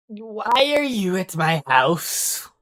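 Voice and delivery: put-on a slow, put-out old gnome at his door; old man voice. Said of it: "like, an old man voice".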